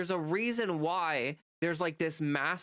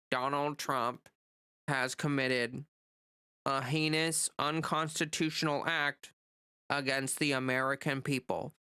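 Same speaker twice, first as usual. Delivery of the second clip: a hint of a droning senator, lower; lifeless Schumer impression; 3 semitones lower than usual. Schumer reading from paper, lightly voiced lower lifeless drone. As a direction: read it like you don't care. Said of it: "You sound like you're dying".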